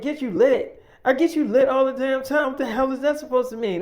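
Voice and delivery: loud, high retold outrage; indignant comeback. Woman challenging David's request to get him lit, indignant. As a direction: throw it back loud and high, incredulous.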